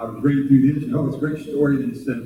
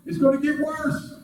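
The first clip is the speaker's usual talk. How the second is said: high panicked voice, mock fear; frightened disciples; slower than usual. Preacher voicing the disciples panicking in the storm, high and scared. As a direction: squeak it out like scared fishermen. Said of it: "They was like".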